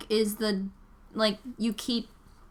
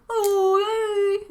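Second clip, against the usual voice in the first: much higher, drawn-out mock cheer; super enthusiastic; 10 semitones higher, 3 dB louder than usual. Dog owner greeting another dog walker, super enthusiastic. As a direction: imitate an overeager stranger in a high, stretched voice. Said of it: "he was, like, super enthusiastic".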